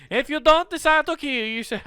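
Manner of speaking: put-on high-pitched, botched European accent; hammed-up, badly faked Swiss accent; accidentally Swiss accent. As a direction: try Italian, slip into a Swiss accent. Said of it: "Damn it, I went Swiss".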